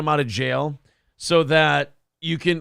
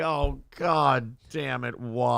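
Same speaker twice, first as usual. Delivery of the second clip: slow, low, fed-up mom groan; weary mom; 4 semitones lower, much slower than usual. Retold or imitated. Commentator voicing the fed-up mom, slow and low. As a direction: drag it out like a tired mother. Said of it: "the mom's just like".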